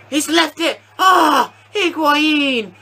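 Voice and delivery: high-pitched cries at a near miss; agonised commentary. Real, not acted. Gamer watching his striker miss, high agonised cries. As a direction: cry out in real dismay. Reacting to a missed chance.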